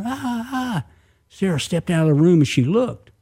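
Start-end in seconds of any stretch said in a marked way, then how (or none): 0.0-0.9 high, subdued "ah, ah, ah" holler; mock a distant holler, high and soft; imitated hollering. A narrator's reading. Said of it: "Somebody was hollering".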